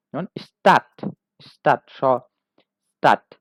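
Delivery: স্টার্ট said loud, with a plain স sound; German s before a consonant. Shown as a demonstration. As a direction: repeat স্টার্ট clearly. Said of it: "স এর মতো উচ্চারণ হচ্ছে". (স উচ্চারণে স্টার্ট)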